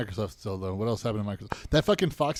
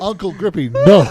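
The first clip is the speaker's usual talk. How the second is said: shrieking laugh, high and loud; laughing through pain; 7 semitones higher, 15 dB louder than usual. Real, not acted. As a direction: squeal it out while cracking up. Laughing hard during joke.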